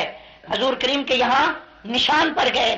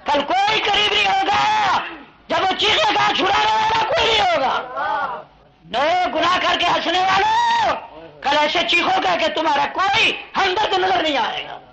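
high, crying-out preacher's warning; impassioned hellfire preaching; thundering warning sermon; 6 semitones higher than usual. Real, not acted. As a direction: warn the sinners in long high cries. Preacher warns sinners of hell.

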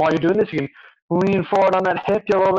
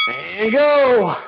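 calling out the start; 3 semitones higher than usual. Real, not acted. Trainer starts the class on lunges, calling out. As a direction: launch the set with a long rising-falling call.

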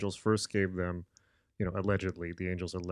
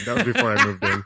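laughing; 11 dB louder, faster than usual. Real, not acted. Joking he had the book.

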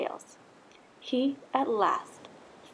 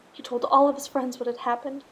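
ordinary delivery, nothing marked

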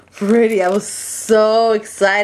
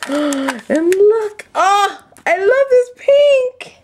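gasp, then high-pitched thrilled gushing; thrilled squeal; 14 semitones higher, slower than usual. Real, not acted. Crafter spotting the pink doilies, thrilled squeal.

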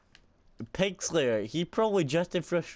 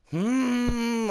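frustrated whine; 11 semitones higher, 5 dB louder than usual. Real, not acted. Frustrated whine mid-game.

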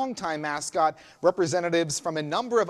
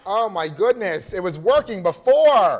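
loud, frustrated cry at failing clip; exasperated outburst; 3 semitones higher, 9 dB louder than usual. Real, not acted. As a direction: blurt it out, exasperated. Clip fails to play live.

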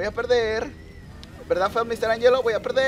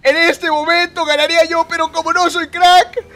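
1 excited louder; 9 semitones higher, 10 dB louder than usual. Real, not acted. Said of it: "Vale, chicos".

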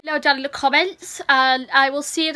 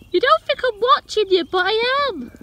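playful teasing; 8 semitones higher than usual. Real, not acted.

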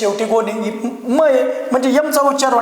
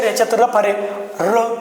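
usual speaking voice

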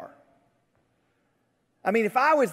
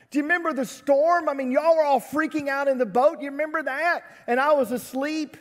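higher-pitched mock scolding, pressing each question; exasperated chastising; 7 semitones higher, 3 dB louder than usual. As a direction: chastise them like a fed-up leader. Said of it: "I would have kind of chastised him".

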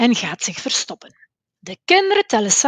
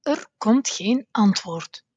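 ordinary delivery, nothing marked

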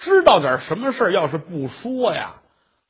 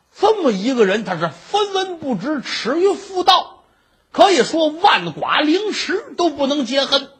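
high-pitched, hammed-up condemnation; storyteller acting out a venomous tirade; vicious cursing; 8 semitones higher than usual. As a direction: build to a damning verdict. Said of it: "咬牙切齿， 胡说八道， 这么一诅咒人家".

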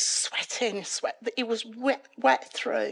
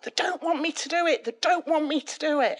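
imitated screaming out; 6 semitones higher than usual. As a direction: mimic a panicked cry in your sleep, held back. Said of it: "screaming out".

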